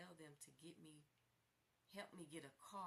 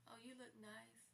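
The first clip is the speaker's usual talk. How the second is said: tearful; 6 semitones higher than usual. Real, not acted. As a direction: let the tears catch it. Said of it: "I'm in tears saying this".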